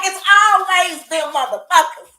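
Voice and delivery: loud, high outraged cry; outraged shout. Real, not acted. Reacting to the rich man on the show, a loud outraged shout. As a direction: yell it in disgust at the screen.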